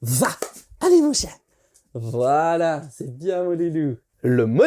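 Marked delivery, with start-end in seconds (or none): 0.0-1.3 talking to a dog. Shown as a demonstration. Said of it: "on va lui dire". 1.9-4.0 soft, low praise for the dog; talking to a dog. Shown as a demonstration.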